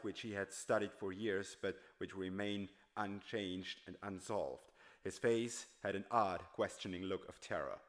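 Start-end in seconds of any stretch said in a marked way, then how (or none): none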